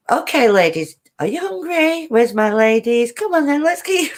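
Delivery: husband baby-talking his cats, played up high; imitating husband with cats. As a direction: mimic the husband cooing at the cats. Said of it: "And he goes".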